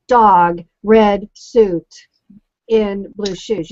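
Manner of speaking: slow, choppy "dog... red suit... in blue shoes", a pause between chunks; stilted keyword title. Shown as a demonstration. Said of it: "you don't want anything stilted".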